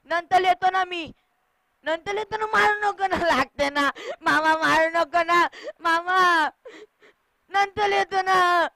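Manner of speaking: high, loud whine while being hit; little child pleading in pain; child's wailing protest. Acted. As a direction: yelp and beg for mercy. Child begs uncle not to hit.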